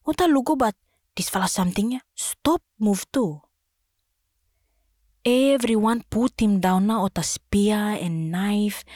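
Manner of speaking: ordinary delivery, nothing marked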